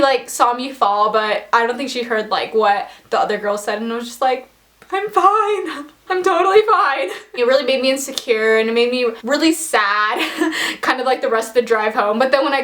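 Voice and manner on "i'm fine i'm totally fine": high-pitched, unconvincing reassurance; feigned fine. Retold or imitated. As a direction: insist too brightly that all is okay. Acts out pretending to be fine.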